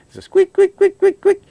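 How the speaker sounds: mock belt squeal up high; imitated belt squeak. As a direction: squeak it out like a slipping fan belt.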